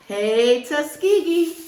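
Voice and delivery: loud, high rally cry; cheering for the school; cheer call. Acted. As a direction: shout it like a homecoming cheer.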